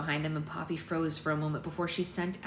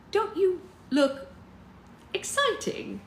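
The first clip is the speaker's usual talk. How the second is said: very high, slow and drawn out; gushing, judging mother; 13 semitones higher, 5 dB louder, slower than usual. A narrator's reading. Mother appraising daughter's outfit, high mannered drawl. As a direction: coo it high with a pause before the verdict.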